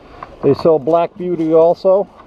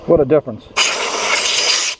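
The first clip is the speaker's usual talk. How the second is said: muffled inside a helmet; speaking from inside a helmet; 2 semitones lower than usual. Real, not acted. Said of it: "you can hear my helmet hitting the".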